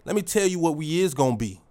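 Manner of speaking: hyping up his own reflection, tough and loud; thuggish affirmation. Shown as a demonstration. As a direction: talk to the mirror like a hard street guy. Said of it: "real thuggish".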